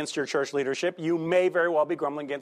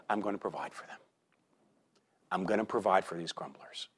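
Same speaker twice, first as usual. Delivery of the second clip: much softer, lower and tender; gracious God; 7 semitones lower, 11 dB quieter, faster than usual. Acted. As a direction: speak God's promise gently to the grumblers. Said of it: "God is so gracious to say".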